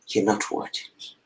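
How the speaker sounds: a quick, soft, breathy whisper of the cruel words; whispered echo. Retold or imitated. Echoing the words that rejected her, in a quick whisper. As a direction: let it drop to a hurt whisper, fast.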